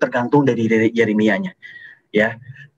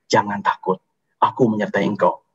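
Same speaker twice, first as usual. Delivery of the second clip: gentle reassurance; 8 semitones lower than usual. Acted. God reassuring Jeremiah.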